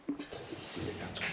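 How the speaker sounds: tense whisper. Real, not acted. Chuchote, tendu, dans le noir. (chuchotement tendu)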